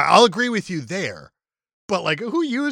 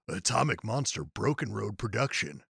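movie trailer voice; 10 semitones lower, 11 dB quieter than usual. Acted. Reading opening credits dramatically.

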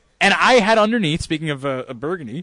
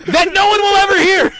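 gleeful; 13 semitones higher, 5 dB louder than usual. Real, not acted. Gleeful laughing emphasis.

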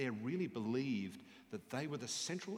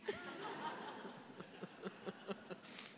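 soft breathy laugh; 12 semitones higher, 8 dB quieter than usual. Real, not acted. Speaker laughs at punchline.

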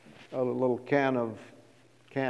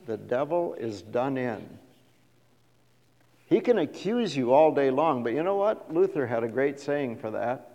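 ordinary delivery

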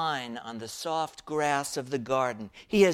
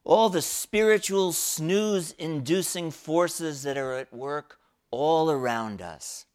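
normal talking voice

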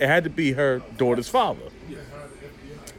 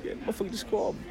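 quick hushed retort; 5 semitones higher, 8 dB quieter, much faster than usual. Retold or imitated. Teller's own retort, quick.

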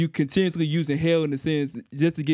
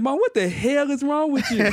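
high-pitched mock outrage; hammed-up, high exasperated retelling; retold mock exasperation; 11 semitones higher than usual. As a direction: play up confronting your mom for a prank. Retelling prank cussing at mom.

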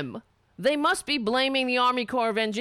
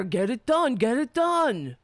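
mock scream. Host mocking how leaders lead, mild mock scream. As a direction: imitate a barked order, half-hearted. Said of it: "They scream at people".

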